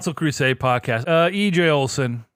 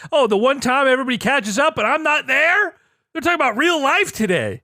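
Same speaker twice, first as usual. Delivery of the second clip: mock indignation; 12 semitones higher, 4 dB louder than usual. Mock quote of own reaction.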